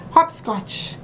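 ordinary delivery

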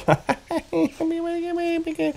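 high-pitched goofy babble; silly high squeal. Pretend. Gamer clowning in a hat, silly high squeal. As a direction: squeal nonsense in a high, small voice. Goofing about with a makeshift hat.

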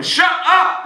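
sudden high, loud shout; demonstrated shout. Shown as a demonstration. Hypnotist modelling what the laughter police yell, shouted. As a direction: blast it out as a model outburst. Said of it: "you can tell me".

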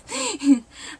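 short high burst of laughter; bright laugh. Real, not acted. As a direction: laugh out loud at yourself. Laughs at own remark.

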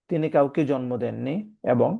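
normal talking voice

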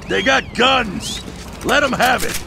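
high, loud battle cry; alarmed shout. Acted. Steve shouting to his crew as guns appear, high and loud. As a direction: yell it over the gunfire. Gunfight erupts, calling out.

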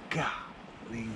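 usual speaking voice

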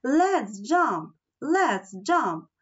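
eager urging that climbs high; mildly frantic storybook urging; urgent exclamation. A narrator's reading. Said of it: "Nelly exclaimed".

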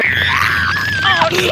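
usual speaking voice

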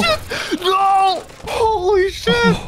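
shocked high yelps; startled shout. Real, not acted. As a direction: yell out in sudden fright. Friend's jump scare.

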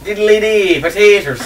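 a jokey stage-Irish line; mock Irish accent. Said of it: "do your Irish thing".

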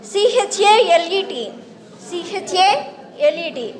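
letters spelled out one by one, slowly; slow spelling out. Shown as a demonstration. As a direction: read the letters slowly for the class. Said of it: "షలై".

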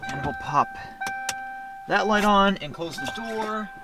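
ordinary talk

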